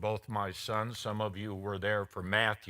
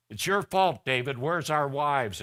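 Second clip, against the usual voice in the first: accusing troops; 3 semitones higher, 8 dB louder than usual. Retold or imitated. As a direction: hurl the blame at David as the angry troops. Troops blaming David, quoted.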